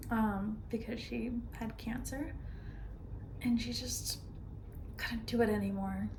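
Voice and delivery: soft, halting sadness; subdued grief. Acted. Telling of best friend's cancer, soft subdued grief. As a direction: tell it softly, with pauses.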